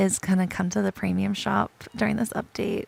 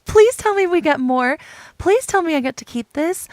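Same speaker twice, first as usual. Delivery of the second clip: excited pleading; 4 semitones higher, 8 dB louder than usual. Real, not acted. Begging to keep the item.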